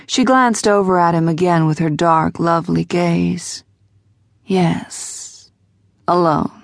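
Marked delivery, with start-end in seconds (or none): 4.4-6.5 smoky voice. A narrator's reading. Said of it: "Her voice was as smoky as her cigarette".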